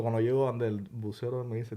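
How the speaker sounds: ordinary delivery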